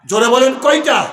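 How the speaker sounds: loud call to the crowd for an answer; rallying shout. Real, not acted. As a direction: shout the question to rouse a response. আবার জোরে সাড়া চাইছেন. (জোরে হাঁক দিয়ে প্রশ্ন)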